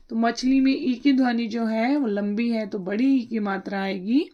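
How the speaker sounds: ordinary delivery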